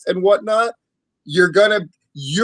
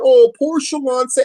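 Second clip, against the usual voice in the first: mock heel; 8 semitones higher, 4 dB louder than usual. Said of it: "a heel tries to say something".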